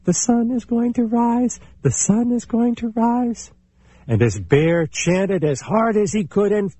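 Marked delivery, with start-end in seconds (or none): 0.0-3.5 very high little chipmunk chant; sing-song it high and tiny; the chipmunk chanting back as light appears. A narrator's reading. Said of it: "little Chipmunk next to him was saying".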